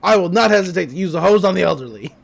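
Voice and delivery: loud, hammed-up order to clear out; acted angry yell. Grunkle kicking customers out of the shop, mock angry yell. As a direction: yell it like a shopkeeper throwing everyone out.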